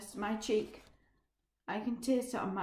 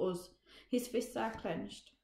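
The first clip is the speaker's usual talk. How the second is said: usual speaking voice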